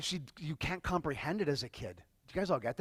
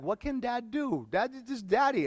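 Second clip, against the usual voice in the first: imitating his little daughter; 8 semitones higher than usual. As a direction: voice her like a hurt six-year-old. Daughter quoted crying to dad.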